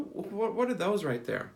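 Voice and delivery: ordinary delivery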